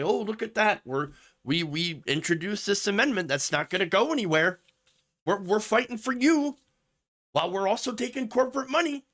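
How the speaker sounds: high, whiny mock politician voice. Mocking politicians who fake fighting for you, in a high sneer. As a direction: sneer it like a smug congressman. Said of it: "and say".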